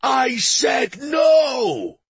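high, drawn-out furious shout; angry shout. A narrator's reading. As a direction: shout it out slowly, at the end of your patience. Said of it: "Stavin shouted".

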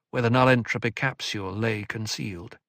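ordinary delivery

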